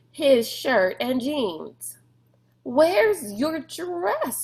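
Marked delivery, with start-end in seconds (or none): 2.6-4.4 high, slow startled blurt. A narrator's reading. Rob blurting at Sistine about her missing dress, startled. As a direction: blurt it out in disbelief. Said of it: "he blurted".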